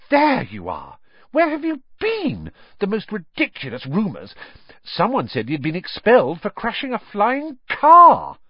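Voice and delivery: put-on a girl bursting with rumours, pitch soaring; excited Hermione. Hermione rushes up, breathless news.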